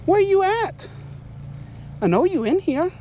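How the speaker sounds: high, slow singsong calling; mocking high singsong calling out a snake; taunting singsong.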